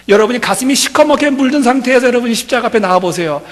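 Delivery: loud, high preaching cry; preaching at full force. Acted. Pastor urging the congregation to come, loud preaching.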